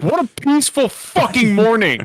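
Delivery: mock scream. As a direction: holler it sarcastically, not full force. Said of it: "just me suddenly screaming".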